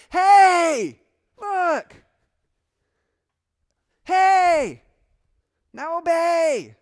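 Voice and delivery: huge high shout, long held words; God calling out. Acted. Preacher voices God calling rebellious people to obey, shouting. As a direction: bellow it to get a wayward people's attention. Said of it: "In his way of saying".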